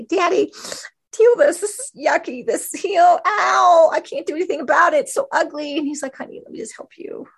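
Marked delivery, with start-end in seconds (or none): 0.0-5.8 put-on high, crying, whining little child; whiny child. Preacher voicing a child crying out to Daddy, whiny. 6.2-7.3 soft whispered comfort; tender whisper. Acted. God answering the hurting child, tender whisper.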